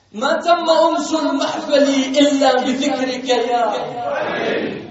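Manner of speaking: very loud, slow declaimed line; powerful recited verse. Real, not acted. The preacher declaims the poem line to the gathering, very loud. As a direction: throw the verse to the whole hall. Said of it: "قوية".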